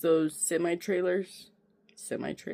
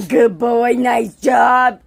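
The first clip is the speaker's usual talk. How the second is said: loud praise; 3 semitones higher, 10 dB louder, slower than usual. Real, not acted. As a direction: shout the praise. Praising dog loudly.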